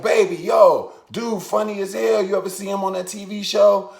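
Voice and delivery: high, loud starstruck gushing; excited fan. Retold or imitated. Fan tells his girl about the TV comic, excited. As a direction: imitate an excited fan telling a partner.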